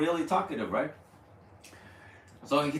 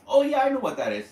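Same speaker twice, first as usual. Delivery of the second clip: high, quick, loud reply; a hint of excited Martin; mock eager; 9 semitones higher, 5 dB louder, faster than usual. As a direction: toss it off eager and fast. Martin quoted in retold story.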